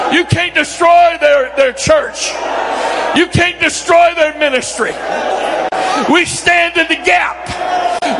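rebuking the devil. Acted. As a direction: cast the devil out firmly.

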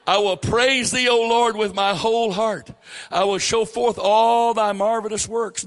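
loud, high preacher's reading of "I will praise thee"; scripture reading. Real, not acted. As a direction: proclaim the psalm to the church.

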